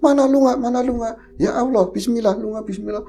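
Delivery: frantic prayer. Retold or imitated. Mother shooing ghost, praying.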